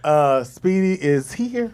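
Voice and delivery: slow, lazy announcement; mock slow arrival announcement; slow drawl. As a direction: drag it out slowly. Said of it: "just be like".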